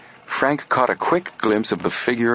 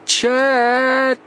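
high, long, loud yell; yelling together; 4 dB louder than usual. Acted. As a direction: yell with all your breath. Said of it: "both boys yelled together".

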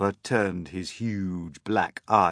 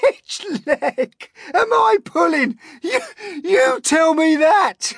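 very high, loud, slow squeal; hysterical glee; 12 dB louder, slower than usual. A narrator's reading. Centipede teases the Earthworm, gleeful hysterics. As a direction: squeal the joke in helpless glee. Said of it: "wriggling with glee".

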